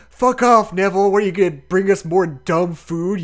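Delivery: loud, high mocking shout at Neville; angry yell. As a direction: bark it out like a furious bully. Seamus yelling insult at Neville.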